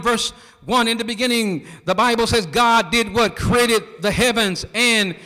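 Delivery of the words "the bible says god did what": ordinary delivery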